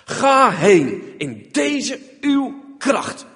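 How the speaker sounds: ponderous, drawn-out command; slow divine command. Acted. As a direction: lay down every word slowly like God speaking. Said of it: "Gods antwoord". (langzaam, plechtig bevel)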